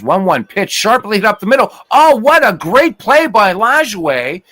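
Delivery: rising play-by-play thrill over a great defensive play; live baseball call, swept up in the moment; excited call. Acted. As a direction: voice jumps high and loud as the ball is hit. A sharp play made live.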